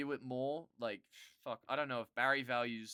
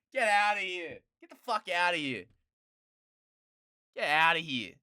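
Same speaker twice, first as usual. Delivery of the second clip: louder get outta here; loud scoffing dismissal; dismissive yell; 4 semitones higher, 10 dB louder than usual. Real, not acted. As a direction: wave it off loudly. Dismissive yelling.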